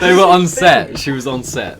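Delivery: loud, high-pitched, through laughter; laughing explanation. Real, not acted. Storyteller defends calling a child a thing, loud laughing explanation. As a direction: protest loudly while cracking up.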